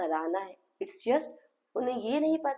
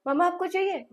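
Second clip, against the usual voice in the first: bright question to mother; 9 semitones higher, 4 dB louder, faster than usual. Shown as a demonstration. Therapist asks mother, modelling.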